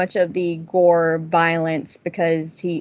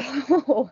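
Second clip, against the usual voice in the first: laughing; 6 semitones higher than usual. Real, not acted. Laughs after the dad story.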